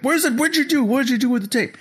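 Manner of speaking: very high, squealed retold questions; mock frantic demand. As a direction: squeak it out like a comic panicked villain. Voices Tatalian after tape vanishes.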